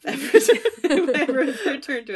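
talking through laughter; laughing. Real, not acted. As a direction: laugh while you say it. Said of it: "That makes me laugh".

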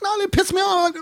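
high-pitched indignant protest; retold complaint. Customer complaining to manager, pissed off. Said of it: "I was like".

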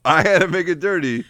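high mocking echo of the accusation. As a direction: throw the line back high and teasing.

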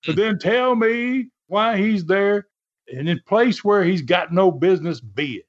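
high-pitched, mock cross-examination; Don Carson as lawyer. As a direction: lightly imitate an indignant wrestler playing lawyer. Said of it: "as if he's a lawyer".

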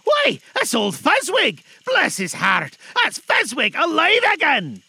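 high, loud joyful cry; great excitement. A narrator's reading. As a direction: shout with delight at seeing an old master alive. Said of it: "cried in great excitement".

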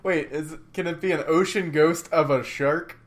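ordinary talk, nothing marked

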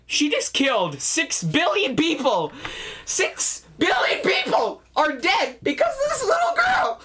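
high-pitched hysterical shriek, slowed for outrage; losing it. Retold or imitated. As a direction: wail it in comic disbelief, close to laughing and crying. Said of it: "you're, like, losing it right now".

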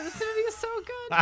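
high, loud gushing; excited gush. Real, not acted. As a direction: squeal with delight. Gushing about the movie.

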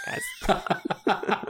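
cracking high with laughter; laughing. Real, not acted. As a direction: squeak it out mid-laugh.

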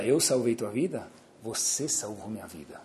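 soft, low, sincere thanks; quiet sincere gratitude. Acted. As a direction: thank him gently, from the heart. Doctor's quiet grateful reply. (médico agradece ao rei, voz baixa e sincera)

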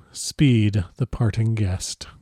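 slow, soft, drawn-out proverb; slow courtly saying. Acted. The Count quoting a proverb to his guest, slowly. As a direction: savour every word slowly.

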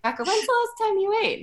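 squeaky inner voice scolding herself; high, chiding self-check; a sudden high nagging inner voice; high-pitched self-nag. Retold or imitated. Self-talk quote, much higher.

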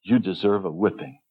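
a hint of a gentle stern father; dad's words. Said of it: "Those times when he said to me".

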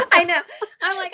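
giggling while talking; laughing. Real, not acted. Laughing at yawning friend.

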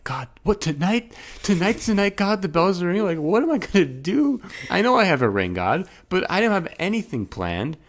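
high-pitched, panicky questioning of God; nervous. Retold or imitated. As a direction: act out a sudden flustered panic, pitch jumping up. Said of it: "all of a sudden I'm nervous".

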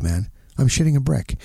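ordinary talk

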